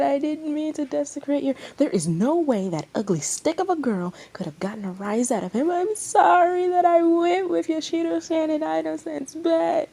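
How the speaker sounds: high, loud panicked babble; frantic overlapping voices. Acted. Crowd excusing themselves to Ren, panicked. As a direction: everyone talking over each other in panic.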